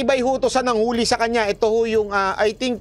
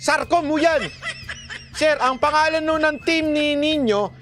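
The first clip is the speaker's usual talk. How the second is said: laughing; 7 semitones higher than usual. Real, not acted. Mocking the police team name.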